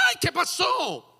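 high startled squeal; a woman caught off guard; flustered surprise. Pretend. Woman greeted by a man, high flustered squeal.

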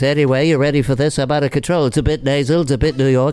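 nasal New York TV host; chatty host, a bit nasal, a bit New York; Regis. Shown as a demonstration. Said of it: "Well, it's Regis".

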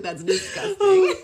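high, slow, laughing disgust. Retold or imitated. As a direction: recoil at it with a laugh. Mock disgust in imagined quote.

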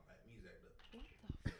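slow breathy whisper; whispered excuse. Real, not acted.

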